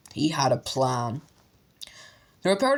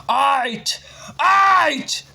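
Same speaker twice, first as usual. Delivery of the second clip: high, very loud yelling; furious shout; 11 semitones higher, 6 dB louder than usual. A narrator's reading. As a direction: bellow them out of the room. Letters flying, Vernon yells.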